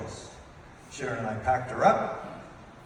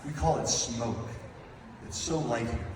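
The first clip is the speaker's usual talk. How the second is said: usual speaking voice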